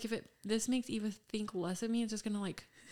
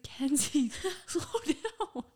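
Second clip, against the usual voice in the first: high-pitched call after someone, breaking into laughter; mock yell, pitched way up; imitated calling out; 7 semitones higher, 3 dB louder, much slower than usual. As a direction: call out as the exasperated boyfriend, half laughing. Retold boyfriend calling after her.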